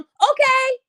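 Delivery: high, breezy send-off from a parent; mock oblivious parent. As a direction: imitate a parent waving the kid off. Voicing an oblivious parent agreeing.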